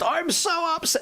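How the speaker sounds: very high, drawn-out mock upset voice; mock upset sibling. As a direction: exaggerate a young kid's self-pity, high and slow. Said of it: "They don't quite say it like this".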